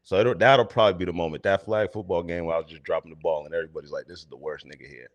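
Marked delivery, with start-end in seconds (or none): 4.1-5.1 low, fast, soft aside; muttered disapproval. Retold or imitated. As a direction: imitate teammates grumbling under their breath. Teammates' muttered verdict, retold.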